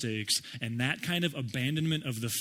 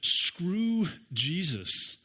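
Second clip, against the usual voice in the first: voicing Peter's curse; 4 semitones higher, much slower than usual. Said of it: "he's more or less saying at this point".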